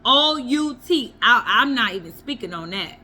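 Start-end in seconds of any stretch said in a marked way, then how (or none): 0.0-1.1 O U T spelled letter by letter, slow and firm. Shown as a demonstration. Refusing to discuss domestic violence, O U T spelled slowly. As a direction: spell out each letter of O U T to make it final. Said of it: "that is out".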